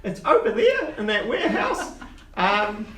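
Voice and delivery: put-on high old gnome voice, eager; squeaky little uncle selling a job. GM holds Quince voice.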